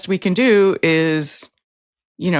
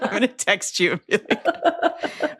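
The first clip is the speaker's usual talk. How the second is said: talking through laughter; giggling through the setup; laughing; 10 semitones higher than usual. Real, not acted. Host laughing as she teases her cohost.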